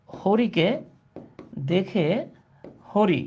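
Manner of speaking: each word set apart by long pauses; word-by-word dictation. Shown as a demonstration. Teacher dictating a Bangla riddle to class, word by separate word. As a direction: dictate one word, wait, then the next.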